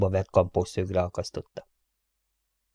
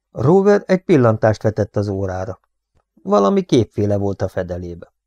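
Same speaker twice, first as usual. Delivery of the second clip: plain reading voice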